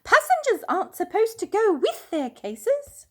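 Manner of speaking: high, loud official voice; girl in charge. A narrator's reading. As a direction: scold from across the belt. Airport girl calling to Paddington.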